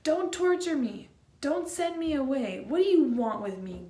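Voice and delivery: loud, high pleading cry; desperate outcry at Jesus; anguished shout. Acted. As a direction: yell it like a tormented man begging. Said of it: "this guy is full on chewing him out".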